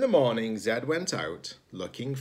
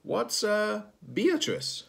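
much higher, puzzled little voice; 12 semitones higher, slower than usual. Acted. An unseen voice questioning Zed, much higher and puzzled. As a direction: ask it high and confused. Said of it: "said a voice".

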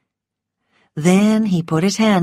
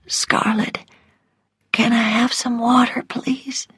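weak, higher whispered request; Melanie's whisper; 6 semitones higher, 3 dB quieter than usual. A narrator's reading. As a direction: whisper a plea for water. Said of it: "heard Melanie whisper".

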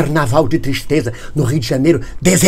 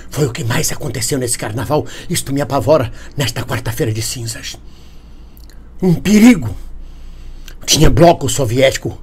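normal talking voice

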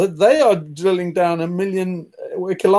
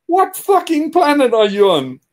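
raised, exasperated disbelief; incredulous retort; 6 semitones higher, 3 dB louder than usual. Real, not acted. Ranting to a friend about clueless people, incredulous and raised. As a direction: scoff at their naivety.

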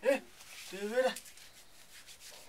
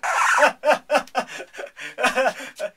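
put-on old man cackle; 2 semitones higher, 8 dB louder than usual. Grandpa laughs at own joke.

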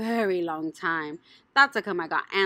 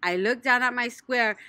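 usual speaking voice

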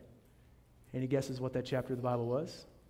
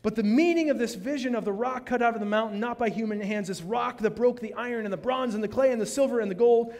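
loud, high scripture reading, hammered home; fiery pulpit reading; preaching at full force; 7 semitones higher, 5 dB louder than usual. Acted. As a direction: proclaim it to the whole hall.

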